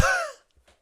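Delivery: a sudden high, loud cackling laugh; loud burst of laughter. Real, not acted. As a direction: crack up loudly at the memory. Laughing at flash mob memory.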